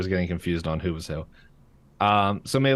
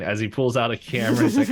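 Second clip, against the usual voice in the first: ordinary delivery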